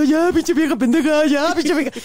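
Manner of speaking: high strained mock yell; mock caller yelling. The beaten caller yelling before hanging up, a high mock yell. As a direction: squeal at her in defeat. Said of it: "empezó a gritar".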